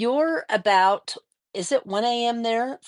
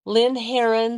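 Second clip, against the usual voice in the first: ordinary delivery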